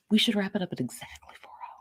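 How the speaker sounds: whispered aside; breathy whisper to the room. Real, not acted.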